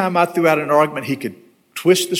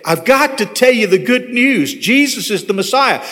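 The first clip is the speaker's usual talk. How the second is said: retold eager testimony, pitched up; raised, urgent, punchy proclaiming; passionate; 7 semitones higher than usual. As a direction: imitate a keen young believer spreading news. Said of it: "he just had that passion".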